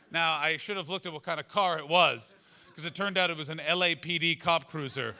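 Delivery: ordinary talk, nothing marked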